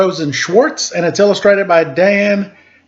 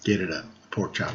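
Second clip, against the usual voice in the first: quick low aside; 9 semitones lower, 12 dB quieter, faster than usual. Real, not acted. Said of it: "reader's aside".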